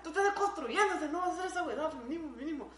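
high, loud laughing chant to herself; mock self-pep talk. Dancing beside her girlfriend, a loud laughing mock pep talk to herself. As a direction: cheer yourself on, half laughing. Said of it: "y yo estaba".

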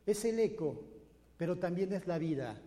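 usual speaking voice

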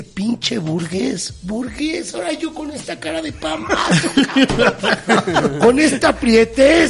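hammed-up angry cyclist; mocking angry cyclist. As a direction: rant like an offended cyclist, laughing. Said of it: "y me dice".

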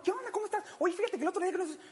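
high, fast, chummy rambling; chatty caller. Retold or imitated. Unknown caller rambling familiarly on the phone, high and fast. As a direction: rattle on like an old friend. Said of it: "suelta hablando la persona".

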